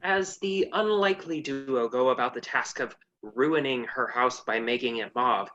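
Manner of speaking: ordinary delivery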